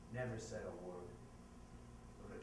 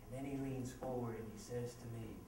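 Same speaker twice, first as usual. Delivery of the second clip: ordinary talk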